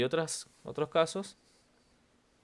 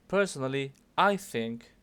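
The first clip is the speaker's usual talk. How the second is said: I punched louder; stress on I; 6 dB louder than usual. Shown as a demonstration. Teacher reminding a student to mark opinion, stress on I. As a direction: hit I hard in "I think". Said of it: "marking out that it's your opinion".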